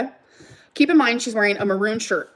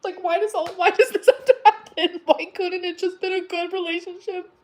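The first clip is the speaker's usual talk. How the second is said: higher, upset voice close to crying; near tears; 7 semitones higher than usual. Real, not acted. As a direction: plead why it had to happen, hurting. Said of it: "makes me wanna cry".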